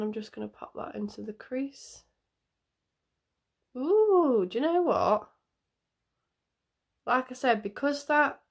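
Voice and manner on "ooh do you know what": normal talking voice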